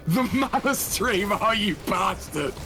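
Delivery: mock outrage. Mock outrage over the title.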